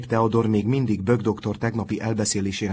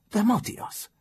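very high, hushed exclamation; reproachful protest; 13 semitones higher, slower than usual. A narrator's reading. Sick boy protesting to his friend, high and reproachful.

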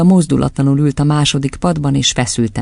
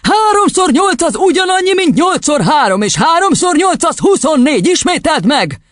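high, furious drilling; yelling; 14 semitones higher than usual. A narrator's reading. Headmistress swinging the boy, yelling the answer. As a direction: hammer the sum into the pupil. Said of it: "üvöltötte".

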